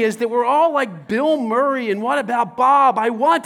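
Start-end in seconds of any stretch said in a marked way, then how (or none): none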